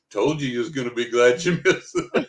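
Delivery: teasing retort breaking into laughter; gleeful, amused comeback; laughing I-told-you-so. Retold or imitated. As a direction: tease them, laughing through the line.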